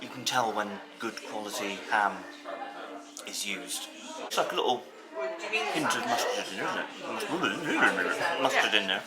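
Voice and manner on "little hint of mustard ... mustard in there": talking through a mouthful; words round a bite of sandwich; mouth full. Real, not acted. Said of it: "I mustn't talk with my mouth full".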